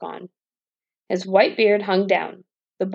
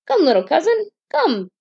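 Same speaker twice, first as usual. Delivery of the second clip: cried out; 9 semitones higher than usual. A narrator's reading. Boy beckons the coffin bearers' dead man, calling out. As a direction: call the dead cousin over, up high. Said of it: "cried out".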